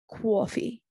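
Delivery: a light, half-hearted Jersey accent on "Coffee", said like "cwoffee"; Jersey accent try. Shown as a demonstration. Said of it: "I'm trying to do a Jersey accent".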